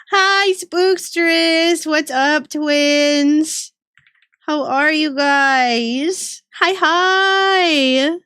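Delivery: high, drawn-out welcome; excited greeting. Real, not acted. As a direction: stretch the hellos out with delight.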